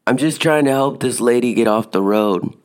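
retold calling out. Retold calling back to the yeller.

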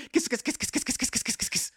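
high, quick hissy string of kisses; rapid kissing chant. Retold or imitated. Reading out a video sign-off, chanted high. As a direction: rattle off KISS in a light, high patter. Reads repeated kisses from description.

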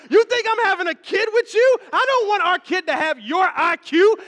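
high, loud comic tirade; yelling angry wife. Acted. Wife berating husband over the rained-out boat date, comic furious yelling. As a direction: tear into the partner, hammed-up and loud. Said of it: "And they're yelling".

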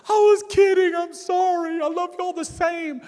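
put-on high, loud wailing little-boy cry; imitating sobbing child. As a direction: wail it out like a sobbing six-year-old. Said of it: "he breaks down sobbing".

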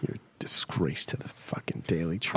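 hushed mock insult; whispered. Real, not acted. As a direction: whisper it to him.

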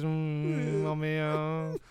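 lightly voiced contented moan; a hint of a satisfied hummus groaning; mock moan of a happy dip. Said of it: "So he probably goes".

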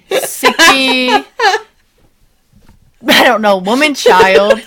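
loud, laughing through the words; real laughter breaking up the insult; laughing tease. Real, not acted. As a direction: crack up while naming your friend. Teasing friend, both laughing.

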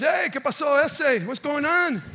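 loud, high-pitched hailing of brothers; breezy Chicano-slang holler; hearty street greeting. Acted. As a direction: call out a big casual hello. Acts David greeting his brothers.

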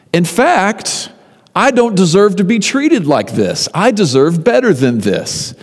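high, emphatic protest of innocence; indignant self-defence. Acted. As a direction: protest your righteousness, pitched high, easing at the very end. Acted indignant speech of Job to accusers.

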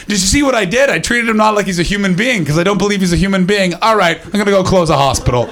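high, cheeky English-person impression; mock cheeky English. Comic imitating English people being dicks, high cheeky gloating. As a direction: gloat cheekily, pitched well up. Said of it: "you do it in a very cheeky".

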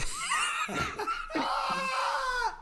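squeaky burst of laughter; high squealing laugh. Real, not acted. Laughs at own voice.

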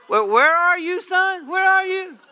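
put-on high, loud, urgent call to a hidden boy; father shouting into the dark garage, high and loud, searching for the voice; alarmed calling out. Dad calls out to the stranger in the trunk, loud and high-pitched in alarm.

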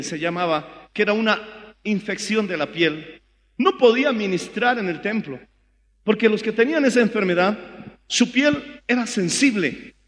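ordinary talk

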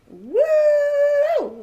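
high whooping yell, held long; hooting scream. Retold or imitated. A retold housemate hooting and hollering with a high woo. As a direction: let out a long high woo like a party shout. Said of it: "Like, screaming".

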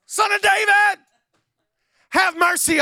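high, loud, desperate cry for help; desperate shout. Acted. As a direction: scream his name across the crowd. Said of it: "He don't know where he's shouting".

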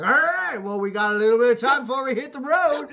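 loud, high rallying holler; rowdy saloon entrance. Acted. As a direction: boom it out to your drinking buddies. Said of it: "walking into the saloon with".

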